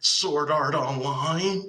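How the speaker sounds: high, drawn-out, laughing nerd voice; mock anime nerd. Teasing her friend by imitating a smug anime fan, laughing. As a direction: ham up a smug fan bragging about his anime. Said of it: "hit somebody who's like".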